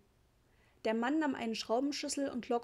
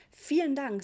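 startled, high and louder thanks; 4 semitones higher, 6 dB louder than usual. A narrator's reading. Mother slamming the door on the mosquitoes, startled. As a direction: blurt it out in fright. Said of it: "sagte sie erschrocken".

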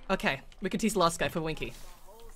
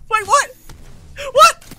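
high loud disbelief; shocked; 15 dB louder, much slower than usual. Real, not acted. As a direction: cry out in disbelief. Shocked at enemy.

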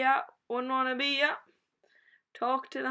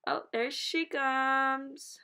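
ordinary talk, nothing marked

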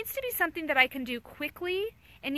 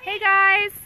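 calling out; 5 semitones higher, 11 dB louder than usual. Real, not acted. Calls to approaching children.

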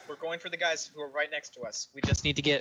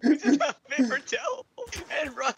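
laughing; 9 semitones higher, 5 dB louder than usual. Real, not acted. Laughing outburst.

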